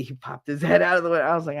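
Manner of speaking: usual speaking voice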